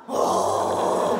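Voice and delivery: loud breathy let-down oh; disappointed oh. Retold or imitated. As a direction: groan it like a disappointed friend. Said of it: "tu te piques pas".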